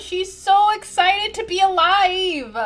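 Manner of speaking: high, loud, drawn-out delight; excited gushing. Real, not acted. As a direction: gush slowly over something adorable, voice way up. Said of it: "I'm so excited about Bambi".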